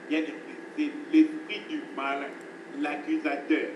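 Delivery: ordinary delivery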